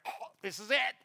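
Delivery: mock strangled last words; hammed-up dying gag; choking. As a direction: pretend to choke while talking. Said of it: "getting stuck in Blockbuster's throat".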